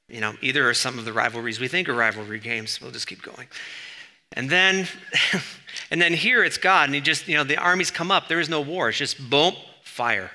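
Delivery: ordinary delivery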